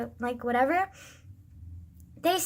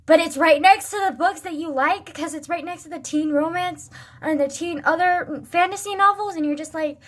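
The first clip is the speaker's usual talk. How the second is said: normal talking voice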